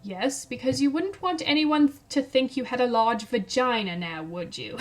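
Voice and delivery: badly faked South African accent, dropping at the end; terrible South African accent. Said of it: "in his very South African accent, which I will do terribly".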